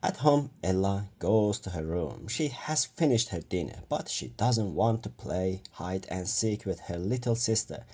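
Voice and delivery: ordinary delivery, nothing marked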